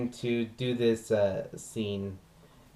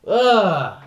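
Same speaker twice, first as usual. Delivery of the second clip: disgusted groan; 9 semitones higher, 9 dB louder than usual. Acted. Disgusted end of monologue.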